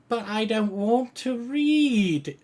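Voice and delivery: put-on very high, loud, slow child whine; high-pitched kid's complaint; whining little girl. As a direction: drag it out like a protesting child. Little halfling girl protests.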